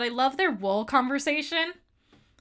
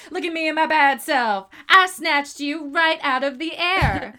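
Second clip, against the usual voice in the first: louder, a bit higher cocky quote; imitating Kuzco; 5 semitones higher, 6 dB louder, slower than usual. Fan quoting Kuzco's boast to a friend, cocky. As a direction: strut through the line like Kuzco. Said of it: "and he goes".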